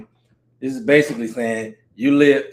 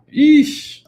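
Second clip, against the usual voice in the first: high yeesh squeal; 11 semitones higher, 6 dB louder than usual. Real, not acted. Excited reaction.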